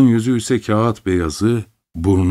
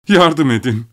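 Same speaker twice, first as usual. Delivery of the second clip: a mild outcry, a bit louder; cry for help; 4 semitones higher, 3 dB louder than usual. A narrator's reading. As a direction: call out for help. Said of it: "Sonra haykırdı". (yardım için haykırış)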